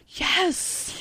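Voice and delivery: high, breathy little yes of triumph; triumphant yes. Retold or imitated. Mom's triumph as son eats beans.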